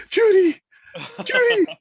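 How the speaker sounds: a mother screaming her daughter's name across the water; frantic shout. Retold or imitated. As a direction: yell it like a mother in panic. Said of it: "She's like".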